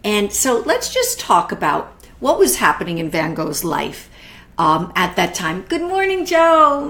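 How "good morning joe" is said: delighted greeting. Real, not acted. Greeting her sister-in-law.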